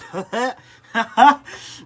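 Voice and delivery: sudden burst of loud laughter. Real, not acted. Laughing at own joke about being arrested, loud laugh. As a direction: break into a big real laugh.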